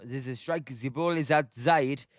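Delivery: put-on half-hearted German-accented announcer; attempted German accent. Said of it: "I can't do Scottish or German".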